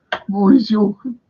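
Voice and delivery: mock-insistent only joking. Shown as a demonstration.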